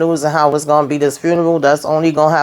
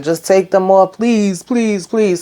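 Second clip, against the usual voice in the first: higher-pitched imitation of begging; retold begging, pitched up; pleading; 4 semitones higher, slower than usual. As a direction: plead lightly in a raised voice. Retold prisoner begs officer for release.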